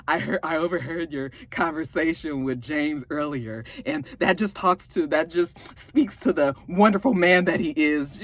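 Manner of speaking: eerie Ramona. Acted. Acts eerie Ramona addressing Tasha.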